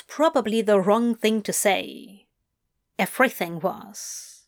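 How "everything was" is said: ordinary delivery, nothing marked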